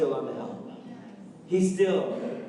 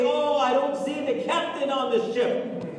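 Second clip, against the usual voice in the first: higher, complaining church member; mocking doubter; 8 semitones higher, 3 dB louder than usual. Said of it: "They will say".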